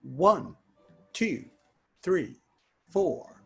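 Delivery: ordinary talk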